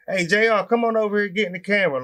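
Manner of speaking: imitating dad calling to his kid; dad's voice. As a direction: call the child over, voice lifted. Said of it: "just to hear his voice saying".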